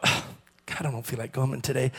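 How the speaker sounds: a soft, breathless, hurried murmur to God; weary murmur. Retold or imitated. Pastor recalling telling God he didn't want to come, soft and weary. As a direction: mumble it like a tired excuse.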